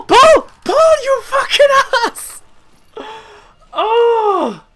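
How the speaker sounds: high panicked shrieks; terrified screaming. Real, not acted. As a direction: scream for your life. Chased by monster.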